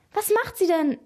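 high, startled little squeak; girlish squeal, mildly acted. A narrator's reading. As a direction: squeak the question in surprise. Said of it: "kiekste Elena".

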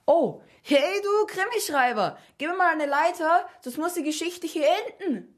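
very high, insistent holler; calling up. A narrator's reading. Ahasverus demanding a ladder from the crime writer, calling up. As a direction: call up to someone far above, exasperated. Character shouts up at the author.

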